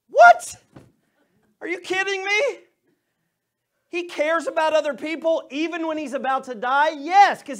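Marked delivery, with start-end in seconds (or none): 0.1-2.6 shouted, much higher, incredulous; astonished. Real, not acted. Pastor marvelling to his congregation, shouting in disbelief. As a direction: gasp it out in disbelief. Pastor acting amazed at Jesus.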